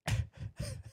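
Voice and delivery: breathless voiceless wheeze of laughter; helpless silent laugh, air only; laughing too hard to make a sound. Real, not acted. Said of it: "I'm laughing so hard".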